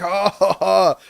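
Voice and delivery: loud mock German bar laugh; German guys laughing. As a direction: guffaw like a pile of Germans in a bar. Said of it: "German guys in the bar".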